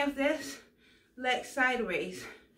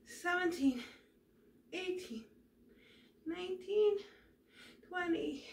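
straining from exercise; 4 semitones higher, 4 dB quieter than usual. Real, not acted. Last reps of the set.

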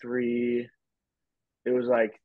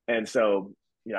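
ordinary talk

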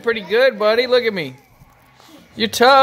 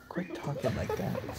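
hushed, much softer telling-off; whispered scolding; 6 semitones lower, 17 dB quieter than usual. Real, not acted. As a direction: whisper a quick reprimand. Parent scolds child quietly.

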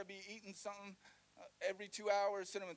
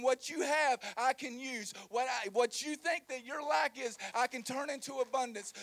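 preached Jesus voice; 4 semitones higher, 6 dB louder than usual. Acted.

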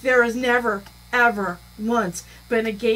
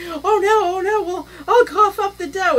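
high, pleading mock voice; mock pleading scammer; 8 semitones higher, 3 dB louder than usual. Imitating the scammer caving in, pleading. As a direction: beg a little, high and comic. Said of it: "he thought that this was going to be like".

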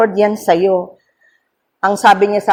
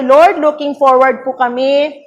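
prayer; 4 semitones higher, 3 dB louder, slower than usual. Acted. Opens closing prayer.

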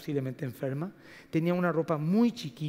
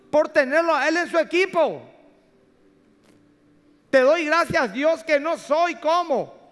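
loud, high, stretched-out pulpit delivery; slow, ringing proclamation to a congregation; full-force preaching; 10 semitones higher, 8 dB louder, slower than usual. Real, not acted. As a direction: preach it at the top of your voice.

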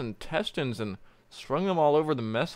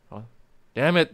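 ordinary talk

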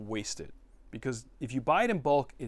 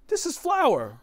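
much higher and louder exclamation; incredulous; 9 dB louder, slower than usual. Real, not acted. Telling the vlog audience about the flour bag, incredulous high exclamation. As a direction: exclaim it in disbelief.

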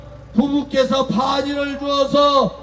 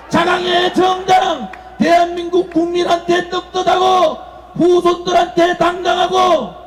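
roaring rallying speech; 4 semitones higher than usual. Acted.

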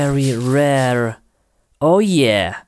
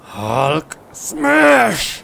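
higher hammed-up Hulk roar; 7 semitones higher than usual. Player clearing monsters as Hulk, roaring. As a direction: roar it like a cartoon brute. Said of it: "Hulk is angry".

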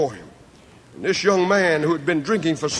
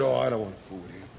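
much softer, fast brush-off; dismissive mutter; 11 semitones lower, 12 dB quieter, much faster than usual. Retold or imitated. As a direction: wave it away under your breath. Preacher quoting his dismissive self.

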